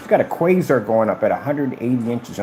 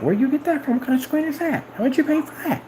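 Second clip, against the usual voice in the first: salesman's questions pitched much higher; imitating salesman. Salesman peppering him with questions, in a much higher mock voice. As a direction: mimic the eager guy, up high. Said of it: "he was asking me".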